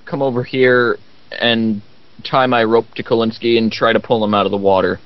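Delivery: ordinary talk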